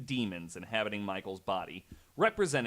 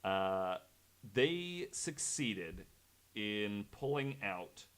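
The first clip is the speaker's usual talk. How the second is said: usual speaking voice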